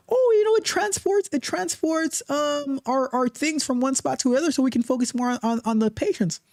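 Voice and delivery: high-pitched mock nurse voice; imitated nurses. Host voicing nurses who praise their robot, high imitation.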